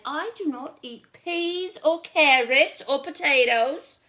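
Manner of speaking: high, loud stubborn list; picky girl listing foods she won't eat; fussy little sister. Acted. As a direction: rattle off everything she refuses. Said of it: "She said".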